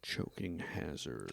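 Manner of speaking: hushed ominous whisper. Acted. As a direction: whisper it like a dark warning. Reading the label warning.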